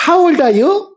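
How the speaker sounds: put-on high smiling question to a child; friendly, with a smile. Shown as a demonstration. Teacher showing how to ask a child's age, smiling high voice. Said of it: "웃으면서 한단 말이야".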